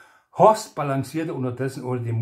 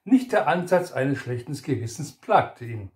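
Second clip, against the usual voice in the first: ordinary delivery, nothing marked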